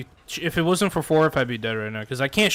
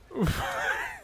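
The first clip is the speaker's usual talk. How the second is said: high squeaky laugh; 6 dB quieter than usual. Real, not acted. Laughing at own joke.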